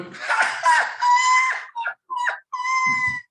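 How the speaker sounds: high, squealing laughing fit; imitated cracking up. Acting out a subway rider cracking up, high squealing laughter. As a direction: burst into loud, helpless cackles. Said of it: "So he starts cracking up".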